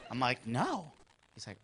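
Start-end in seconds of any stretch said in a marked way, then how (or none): 0.5-0.9 quiet, hushed. Retold or imitated. Hushed reply.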